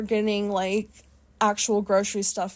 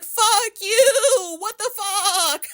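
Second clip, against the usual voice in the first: much higher, louder mock yell, slowing at the end; imitating angry yell; 10 semitones higher, 4 dB louder, much slower than usual. Old lady yells at speeding Jeep, imitated angry yell. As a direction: bark it like the old lady flipping off the car. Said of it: "She's like".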